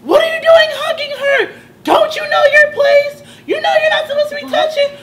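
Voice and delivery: imitating an outraged girl's high scream; loud, high-pitched screamed reprimand. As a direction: shout it high and loud, scolding, as retold. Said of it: "And she's screaming".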